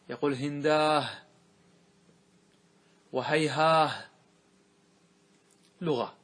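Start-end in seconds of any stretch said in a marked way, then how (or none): 0.3-4.0 هنداه and هيهاه ending on a drawn-out h; rare h ending. Shown as a demonstration. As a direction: say هنداه and هيهاه with a final h instead of t. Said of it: "يقلبها إلى هاء". (الوقف بالهاء: هنداه وهيهاه)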